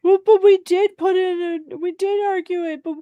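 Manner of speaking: high, whiny mock protest; whining. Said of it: "they'll whine and cry".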